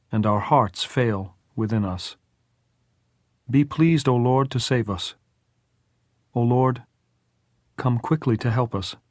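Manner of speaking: precisely spaced lines of a psalm; measured prayer. A narrator's reading. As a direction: recite solemnly, phrase by phrase. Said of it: "precisely measured lines".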